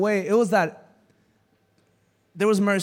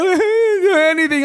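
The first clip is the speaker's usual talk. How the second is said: high, louder whiny plea; crying girl; 9 semitones higher, 5 dB louder than usual. Retold or imitated. Crying girl to cop, high whiny plea. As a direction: play the scared teen insisting. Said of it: "The girl starts crying".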